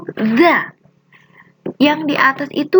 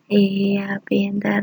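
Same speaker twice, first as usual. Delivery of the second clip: whisper; 6 semitones lower, 6 dB quieter, much slower than usual. Real, not acted. Praising pupils softly.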